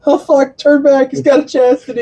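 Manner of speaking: high-pitched, panicked cry to turn back; mock panic. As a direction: yelp it in mock alarm, voice way up. Acting out panicked horror scene.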